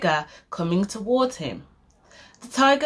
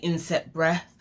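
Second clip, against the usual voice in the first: ordinary delivery, nothing marked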